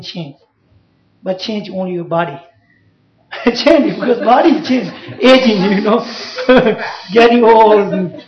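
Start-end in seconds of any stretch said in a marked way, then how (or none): 3.3-8.2 laughing about aging. Real, not acted. Teacher laughing to students about the body aging, amused.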